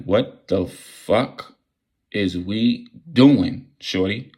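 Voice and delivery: very slow, stretched out what the fuck; drawn-out disbelief. Retold or imitated. Asking the girl what they are doing, very slow and stretched. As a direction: drag every word out in disbelief.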